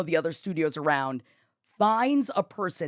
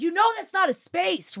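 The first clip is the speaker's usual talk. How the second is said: indignant confrontation, retold; 10 semitones higher than usual. Retold confrontation with bad parker.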